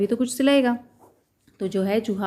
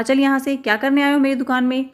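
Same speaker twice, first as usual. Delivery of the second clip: raised, higher and louder scolding; angry shooing; 5 semitones higher, 4 dB louder than usual. Acted. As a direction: drive the mouse out angrily. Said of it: "गुस्सा किया और कहा".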